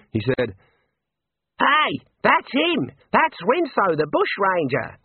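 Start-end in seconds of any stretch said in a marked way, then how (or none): 1.6-5.0 high-pitched yell of recognition; excited shout from the crowd. A narrator's reading. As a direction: call out high and drawn out over a crowd. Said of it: "someone yelled".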